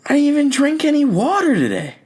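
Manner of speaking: very high, squeaky disbelief; high squeak. Retold or imitated. Kid who just wet himself, squeaking in disbelief. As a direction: shriek it like a shocked little kid. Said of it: "thinking to myself".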